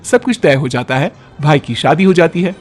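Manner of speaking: ordinary delivery, nothing marked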